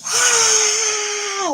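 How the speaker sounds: high, loud imitated shout of release; imitated scream. Son screaming in a parking lot, loud imitated yell. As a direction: belt out a boy's scream like letting it all go. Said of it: "So he went".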